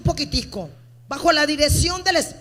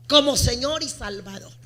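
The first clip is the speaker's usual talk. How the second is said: normal talking voice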